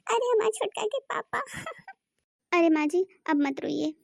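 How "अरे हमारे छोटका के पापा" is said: high, soft comic wail for her husband; hammed-up grieving cry, very high; widow's theatrical lament; mock wailing.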